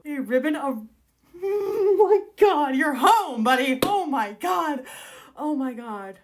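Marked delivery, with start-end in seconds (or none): 1.1-6.2 high, loud, drawn-out gushing; thrilled squeal. Real, not acted. Book fan reacting to a homecoming scene, thrilled. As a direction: squeal it with overwhelmed delight.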